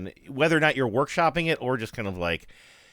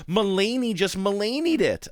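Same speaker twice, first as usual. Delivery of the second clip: excited exclaim; 8 semitones higher than usual. Retold or imitated. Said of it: "And I was like".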